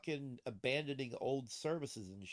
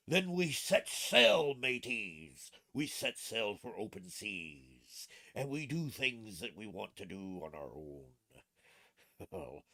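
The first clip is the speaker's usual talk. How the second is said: slow, drawn-out pirate speechifying; rally the crew like a cartoon pirate; mock pirate captain; 4 dB louder, slower than usual.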